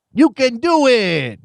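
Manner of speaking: mock Waterboy cheer. Host imitating Rob Schneider's Waterboy line, mock cheer. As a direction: lightly imitate the cajun cheerleader bit. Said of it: "who says".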